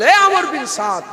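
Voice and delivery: high, loud anguished reproach; Husain's rebuke. Acted. Husain rebuking Amr bin Sad at Karbala, loud and high. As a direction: cry out the betrayal to his face. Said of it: "Hussain হুজুর বলেছিল".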